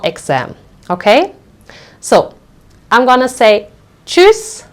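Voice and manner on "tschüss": cheery goodbye. Real, not acted.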